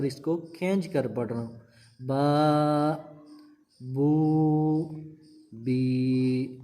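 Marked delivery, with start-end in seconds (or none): 2.1-6.6 با بو بی each stretched long; long vowels. Shown as a demonstration. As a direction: hold each vowel for two beats. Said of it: "یہ ایک الف کے برابر اس کو میں لمبا کر رہا ہوں".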